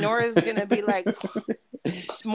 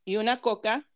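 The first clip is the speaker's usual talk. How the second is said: street vendor call. Shown as a demonstration. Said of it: "you gotta talk like a street vendor".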